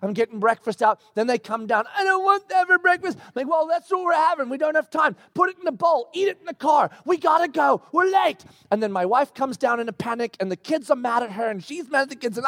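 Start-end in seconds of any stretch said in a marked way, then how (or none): 1.9-3.2 high, put-on childish whine; whining kid imitation. Kid coming down to breakfast, mock whine. 3.5-8.4 high-pitched harried parent orders; acted frantic hurrying. Father rushing kids to the car, frantic.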